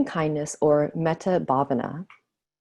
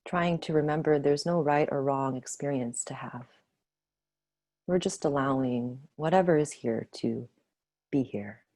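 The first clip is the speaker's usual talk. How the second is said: guided meditation. Acted. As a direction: ease them gently back to the room. Leads closing of meditation.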